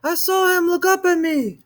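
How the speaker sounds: put-on high, loud panicked child; a scared boy running to his mother; frightened cry. A narrator's reading.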